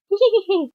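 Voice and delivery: high-pitched imitation giggle; mimicked laughter. Film fan mimicking a character, high mock laughter. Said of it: "He was like".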